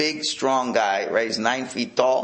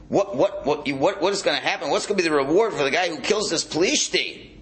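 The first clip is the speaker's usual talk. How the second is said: higher-pitched, disbelieving question; incredulous question; 5 semitones higher than usual. Acted. Teacher voices David challenging the reward, incredulous question. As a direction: ask it as an indignant challenge. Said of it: "as a question".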